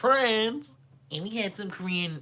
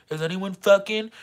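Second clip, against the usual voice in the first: "fucking" louder and higher, rising at the end; higher, insistent question; 5 semitones higher, 6 dB louder than usual. Retold or imitated. Friends searching out the dorm window, higher louder repeated question. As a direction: ask it eagerly, lifting "fucking". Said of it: "trying to be like".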